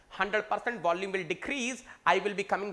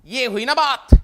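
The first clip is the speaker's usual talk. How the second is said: shouted approval; loud praise; 3 semitones higher, 14 dB louder, much faster than usual. Real, not acted. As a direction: bellow your approval. Teacher praising answer.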